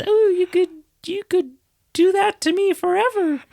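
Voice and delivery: high-pitched mock female lover; mock woman's voice. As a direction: squeak it out like the swooning heroine. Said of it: "she says".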